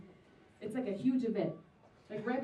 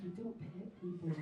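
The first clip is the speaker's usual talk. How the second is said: low, soft and slow; quiet deadpan; 5 semitones lower, 12 dB quieter than usual. Retold or imitated. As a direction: flatly tell him off. Scolding the creep.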